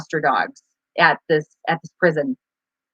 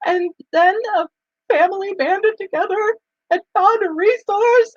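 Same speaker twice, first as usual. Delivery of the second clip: imitating friend reading; 13 semitones higher than usual. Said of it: "she's reading this and she's like".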